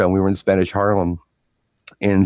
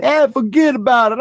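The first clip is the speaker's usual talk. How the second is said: high, exaggerated Brooklyn wise guy; Brooklyn guy accent; 4 dB louder than usual. Contrasting coastal speech with guys from Brooklyn, mock Brooklyn accent. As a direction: put on a hammed-up Brooklyn accent. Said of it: "not the guys from Brooklyn".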